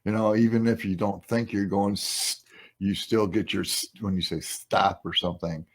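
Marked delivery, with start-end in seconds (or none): 1.9-2.4 an unvoiced hissing "s-"; hissy s sound. Shown as a demonstration. Voice actor warns about sibilants, hissed "s-". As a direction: demo the sharp sibilant "s-". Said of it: "and your S's".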